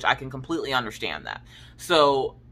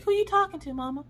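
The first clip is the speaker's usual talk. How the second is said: put-on much higher, small nosy child; little girl voice; 13 semitones higher, 4 dB quieter than usual. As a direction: sound like a curious kid just woken. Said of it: "she'll be like".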